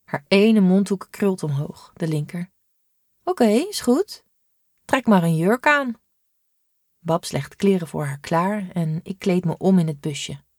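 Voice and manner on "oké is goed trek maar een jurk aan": a touch of amused giving in, pitched up. A narrator's reading. Sister gives in to the boy wearing a dress, amused. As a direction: let it go with a half-smile.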